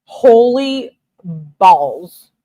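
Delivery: drawn-out amazement. Real, not acted. Reacting to seventeen texts.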